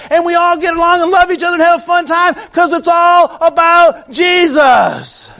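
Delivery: rising, loud sermon climb that ends in a shout on Jesus; full-force preaching. Acted. Pastor rousing the church to make it all about Jesus, building shout.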